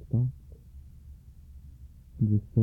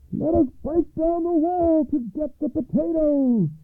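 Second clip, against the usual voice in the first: high-pitched battle call; rally the army in a high cry; soldiers' shout. A narrator's reading. Soldiers urging each other to storm the wall, high shout. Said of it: "soldiers began to shout".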